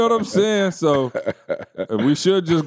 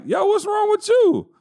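much higher, alarmed "what's wrong"; startled alarm. Retold or imitated. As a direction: react as if your friend just screamed next to you. Narrator's alarmed reaction to friend.

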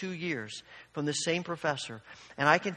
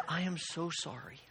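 soft, understated choked-up apology; a hint of a professor near tears, said softly; tearful apology; 10 dB quieter than usual. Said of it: "the tears began to well up in his eyes".

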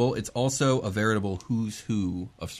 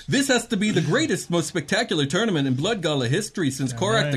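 booming prize announcer; 7 semitones higher than usual. Acted. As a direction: hype up the crowd like a goblin MC. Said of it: "that goblin announcer".

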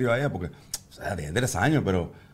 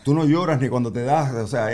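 ordinary delivery

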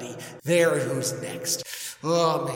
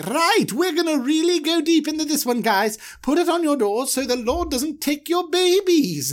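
Aaron high voice; 12 semitones higher, 4 dB louder than usual. Retold or imitated. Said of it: "He's like".

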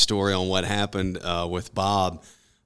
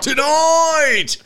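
high, loud battle cry; rallying shout; 7 dB louder than usual. Acted. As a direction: cry it out to the crowd. Show intro battle cry.

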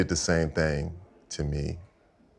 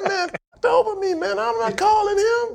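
high-pitched mock shout, drawn out at the end; retold outrage; 4 dB louder, slower than usual. Former player refusing to phone the GM, mock outrage. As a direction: holler the refusal in comic outrage.

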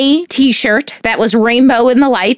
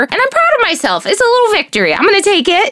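much higher, quick excited self-praise; proud; 10 semitones higher, faster than usual. Real, not acted. Mother cheers her small parenting victory, high excited. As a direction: chirp it out, delighted with herself.

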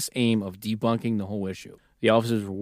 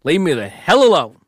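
grumpy shout; 5 semitones higher, 8 dB louder than usual. Retold or imitated.

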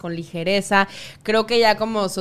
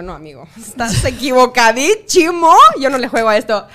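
prim refusal; 3 semitones higher, 10 dB louder than usual. Retold or imitated. To the guy asking her to dance, prim refusal with a laugh. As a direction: turn him down like a prude, laughing. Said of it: "Y yo".

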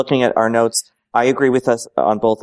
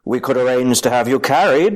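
Tywin Lannister; 4 semitones higher than usual. Acted. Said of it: "Tywin Lannister saying to".

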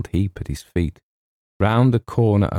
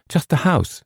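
put-on high, girlish voice; sweet girl; 10 semitones higher than usual. A narrator's reading. Girl visitor answering her reluctant host, high and sweet. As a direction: lift it into a sweet young girl's pitch. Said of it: "said the girl".

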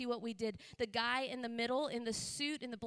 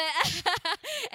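loud, high burst of laughter; real, hearty laugh; 7 semitones higher, 9 dB louder than usual. Real, not acted. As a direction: laugh out loud at your own confession. Laughs after admitting telling her secret.

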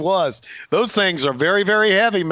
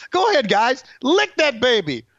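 mock promoter; 6 semitones higher than usual. Promoter to wrestlers live on Fox, mock encouragement. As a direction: urge them on in a pitched-up pretend boss voice.

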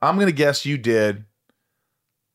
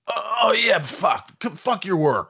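mock outburst; 9 semitones higher, slower than usual. Mock outburst at busy friends.

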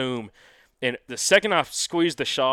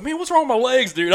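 puzzled exclamation; 11 semitones higher, 5 dB louder than usual. Retold or imitated. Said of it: "I was like".

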